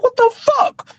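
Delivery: very high, squeaky what the fuck; mock incredulous squeal; stunned disbelief. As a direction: react in shocked disbelief. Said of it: "I said".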